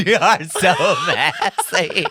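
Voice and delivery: cracking up, loud and high; drawn-out words through helpless laughter; laughing. Real, not acted. Host to co-host who was only joking, laughing hard.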